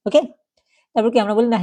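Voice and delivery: normal talking voice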